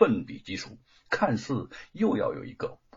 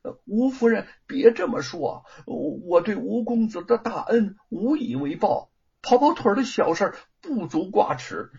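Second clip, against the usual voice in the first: higher, louder, flustered protest; 8 semitones higher, 3 dB louder than usual. A narrator's reading. Thief brushing off the wife's thanks, higher and flustered. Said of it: "贼儿有些慌乱".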